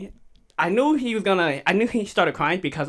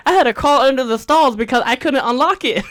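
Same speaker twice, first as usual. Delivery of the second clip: higher, louder, laughing protest; exasperated retelling; 7 semitones higher, 7 dB louder than usual. Retold or imitated. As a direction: act out the kid's loud complaint. Retold brother explaining his bathroom ordeal.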